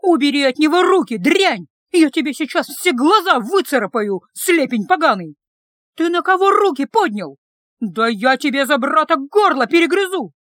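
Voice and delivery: loud, raging screams at a brawler; a sister's furious yell defending her brother. Acted. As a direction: claw at him with your voice, scream it. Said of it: "с воплем врезалась".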